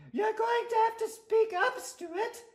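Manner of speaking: very high-pitched prim lady teacher; schoolteacher voice. Acted. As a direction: quiz the class in a high falsetto. Teacher addresses pupil.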